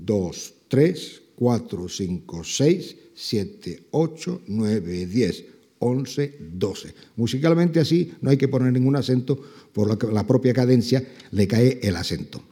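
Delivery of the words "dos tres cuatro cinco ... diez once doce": counting out the rhythm for a student; counting in twelve. Shown as a demonstration. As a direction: bounce the count, beats rising then dropping away. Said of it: "que sería un".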